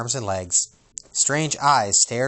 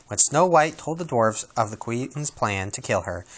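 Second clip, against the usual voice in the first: ordinary delivery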